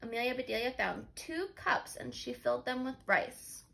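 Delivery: plain reading voice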